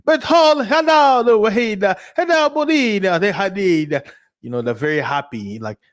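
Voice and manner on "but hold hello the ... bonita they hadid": high, sing-song mock Italian accent. Vlogger imitating Italians as sounding high, mock Italian accent. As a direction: ham up a giddy Italian accent. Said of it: "Italian usually sounds like they're high".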